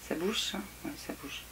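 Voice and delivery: hushed whisper to herself. Real, not acted. Whispering to herself while testing the figure. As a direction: breathe it out, almost voiceless. Checking a toy figure up close.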